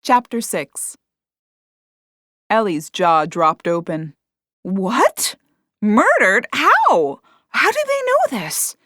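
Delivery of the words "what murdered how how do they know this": rising higher and louder with each question; stunned, climbing into alarm; shocked disbelief. A narrator's reading. Niece hears aunt was murdered.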